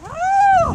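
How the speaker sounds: startled mare. Retold or imitated. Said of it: "she would just be like".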